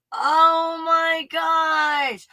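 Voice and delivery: very slow, high shout of amazement; ecstatic. Real, not acted. Vlogger overwhelmed by her revelation, high slow shout. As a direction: cry it out at the peak.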